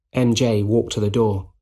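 plain reading voice

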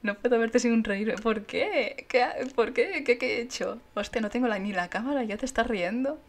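hammed-up loud protest; mock indignant shout. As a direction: play the offended victim loudly. Pretending chat laughs at her.

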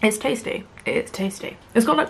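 talking between bites; swallowing while speaking; mouth partly full, chewing. Real, not acted. Seen 1: Forkful eaten at -2.0, chewing at -1.0, lips pressed early on.

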